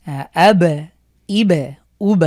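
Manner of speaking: ব of আব, ইব, উব bounced loud; qalqalah on ব. Shown as a demonstration. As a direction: echo each ব. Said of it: "বা একটু দিয়ে আমরা যদি পড়ি".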